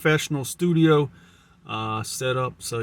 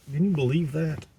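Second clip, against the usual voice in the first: towel over head; 4 semitones higher, 3 dB louder than usual. Real, not acted. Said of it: "The towel is so thick".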